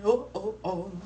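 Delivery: ordinary delivery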